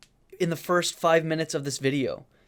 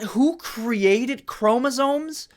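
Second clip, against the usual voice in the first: high, rising, disbelieving echo; incredulous question; 6 semitones higher, slower than usual. Retold or imitated. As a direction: echo it as if you can't believe it. Repeats her question, rising.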